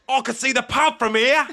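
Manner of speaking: loud, high, hammed-up Australian accent; cartoonish Australian twang; mock Australian accent. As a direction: holler it like a kid faking Australian. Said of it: "I spoke in Australian and I said".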